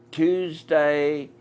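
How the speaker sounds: ordinary talk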